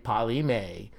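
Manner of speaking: ordinary delivery, nothing marked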